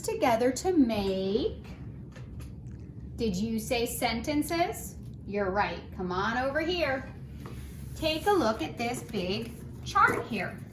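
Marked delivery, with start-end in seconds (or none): none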